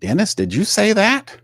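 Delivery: a hammed-up boss calling out his coach; imitating Mickey. Host acting out Mickey questioning Dennis, mock voice. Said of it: "Mickey would turn to him and say".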